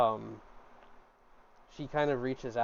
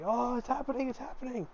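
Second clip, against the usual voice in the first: high, eager gawking at a spectacle; breathless crowd hype, pitched up; gleeful bystanders pointing at the show; excited onlookers; 11 semitones higher, 3 dB quieter than usual. Acted. Students egging on the trance.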